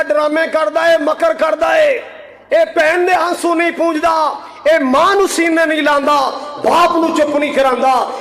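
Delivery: high, indignant sermon delivery; impassioned rebuke from the pulpit; heated preaching. Real, not acted. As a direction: hurl each charge at the congregation. Preaches at full force.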